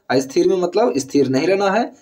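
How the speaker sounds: usual speaking voice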